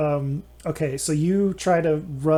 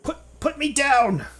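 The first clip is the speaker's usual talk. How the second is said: panicked plea; 11 semitones higher than usual. Acted. Captive held by the ankle.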